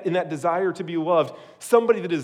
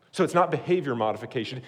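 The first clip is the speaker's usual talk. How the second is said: normal talking voice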